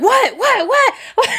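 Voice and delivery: high, rapid-fire startled questions; startled waking. Retold or imitated. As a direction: act out being jolted awake in alarm. Startled man woken by scream.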